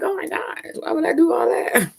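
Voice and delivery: high, exasperated mock whine; playfully aghast at the idea; mock disbelief. Mock exasperated reply to warnings.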